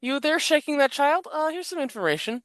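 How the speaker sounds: high-pitched imitation of a helpful bystander; mock waitress voice. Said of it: "here she was like".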